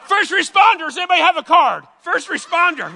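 high, loud mock shout; calling out. Onlookers call out for first responders, high mock yelling.

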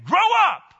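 loud high call of Grow up; loud call and response; congregation shout. Real, not acted. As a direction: shout it back with the church. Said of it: "Everybody say".

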